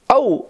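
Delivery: sharp high cry of pain from a needle prick; pained ouch. A narrator's reading. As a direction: yelp as the finger is pricked. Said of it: "rief sie". (hoher kurzer Schmerzensruf)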